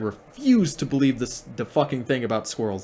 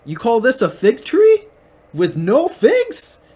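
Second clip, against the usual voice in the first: high, loud mock outrage at the tree; hammed-up indignant shouting; shouting at a barren fig tree in mock fury; outraged Jesus; 8 semitones higher, 6 dB louder, slower than usual. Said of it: "and he's like".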